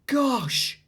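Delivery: high amazed gasp of a word; surprised gosh. Acted. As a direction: sound impressed. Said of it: "said Abigail".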